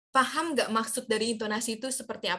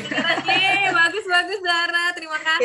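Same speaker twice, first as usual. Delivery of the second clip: excited praise; 6 semitones higher, 8 dB louder than usual. Real, not acted. Praising a student's take.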